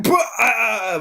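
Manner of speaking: high hammed-up retch; mock retching shout. Thrown out of the bar, he retches with a shout. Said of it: "he's like".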